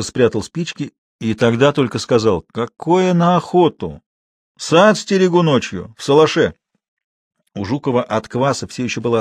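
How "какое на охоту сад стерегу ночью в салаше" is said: put-on higher, slow village old man; drawn-out rustic reply; dismissive old watchman. A narrator's reading. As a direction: grumble back slowly, stretching the words. Матвей, ночной сторож.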